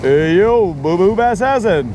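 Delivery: swooping cartoon bear with stretched endings; Yogi Bear. Calling to Boo Boo in the bear's catchphrase, cartoon impression. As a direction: draw the words out like a cartoon bear. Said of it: "Yogi Bear always says".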